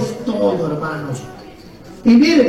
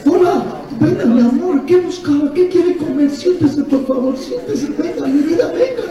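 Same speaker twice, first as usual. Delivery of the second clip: high, honeyed welcome to a diner; a hostess fussing lovingly over a customer; sweet waitress; 5 semitones higher than usual. Pretend. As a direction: coax the guest to sit down, all sugar. Sweetly welcoming a restaurant guest.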